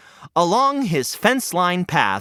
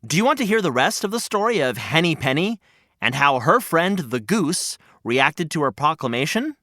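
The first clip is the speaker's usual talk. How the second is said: usual speaking voice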